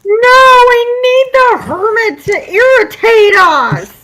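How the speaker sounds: loud, high and drawn out; loud protest. Real, not acted. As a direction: shout it in protest. Player protests dropping the voice.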